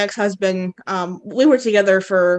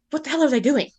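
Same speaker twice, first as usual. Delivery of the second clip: mildly horrified, higher-pitched reaction; retold horror, pitched up; horrified disbelief; 6 semitones higher, faster than usual. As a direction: act out shocked disbelief at what you are watching.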